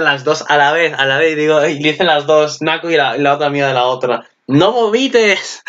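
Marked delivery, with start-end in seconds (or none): 4.5-5.6 high, slow mock cry of no vomites; calls out the warning in a drawn-out, raised voice; mock shout. Retelling the anime, voicing the friend's outburst with a mock shout.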